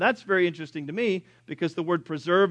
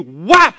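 sudden loud impact noise; explosive "whap"; 11 dB louder than usual. Acted. Preacher imitating fire hitting the soldiers, explosive sound effect. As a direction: burst out a mimicked strike.

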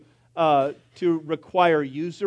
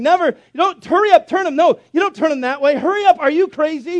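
high, loud panicked yelling at his wife; frantic husband shouting; 9 semitones higher, 7 dB louder than usual. Retold or imitated. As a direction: go bananas over the frying eggs. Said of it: "He just went bananas".

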